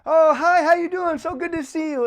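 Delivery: high, loud, gushing hello; hammed-up delighted welcome; enthusiastic greeting. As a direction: greet them like a long-missed friend. Acting out an engaging hug greeting.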